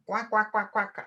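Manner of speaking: flat "quack, quack, quack" like a duck; a silly duck quack. Pretend. Mimics duck after failed flight.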